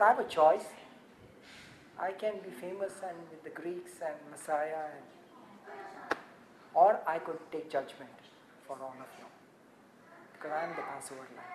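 preacher softly voicing Jesus; soft voiced Jesus. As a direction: speak it as a quiet inner choice.

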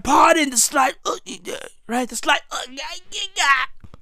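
very soft, cracking, failing voice; losing voice. Real, not acted. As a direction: let the voice die away on stray syllables. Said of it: "I'm losing my fucking voice".

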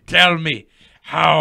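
slow, loud, drawn-out plea; pleading mock demand. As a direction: stretch it out, begging loudly. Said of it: "I was like".

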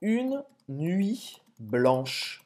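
une nuit blanche drawn out slowly, word by word; slow model phrase. Shown as a demonstration. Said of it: "a expressão seria".

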